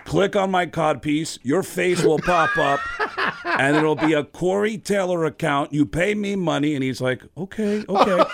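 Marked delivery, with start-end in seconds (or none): 0.0-6.8 slow, loud, raised put-on voice. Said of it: "if you called him as [name]". 7.4-8.2 high, loud placating voice; flustered surrender. Retold or imitated. Singer gives in to scolding.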